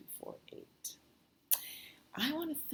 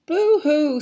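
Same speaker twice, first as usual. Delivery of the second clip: loud, high storybook owl screech; 13 semitones higher, 17 dB louder than usual. A narrator's reading. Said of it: "screeched Owl".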